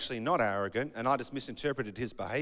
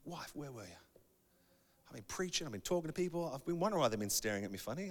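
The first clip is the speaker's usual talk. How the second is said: hushed, put-out grumble; mock wounded complaint; 9 dB quieter, faster than usual. Preacher to wife about unnoticed pimple, hushed mock complaint. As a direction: complain softly, as if embarrassed.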